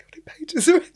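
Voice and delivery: incredulous high-pitched question. Retold or imitated. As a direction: play up the shock at the book's length. Mother shocked at long school book.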